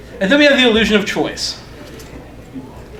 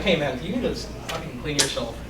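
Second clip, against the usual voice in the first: softer, lower offhand mock remark; low casual aside; 5 semitones lower, 11 dB quieter than usual. Trickster to target while adjusting clothing, quiet casual aside. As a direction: toss it off quietly as a distraction.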